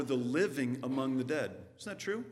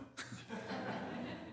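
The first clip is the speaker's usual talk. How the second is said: a quick breathy laugh at his own joke; short laugh; 12 dB quieter than usual. Real, not acted. Pastor laughing at his own Easter joke, quick laugh.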